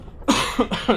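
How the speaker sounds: a short dry demo cough. Shown as a demonstration. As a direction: cough once to show the habit. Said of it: "I need to cough now".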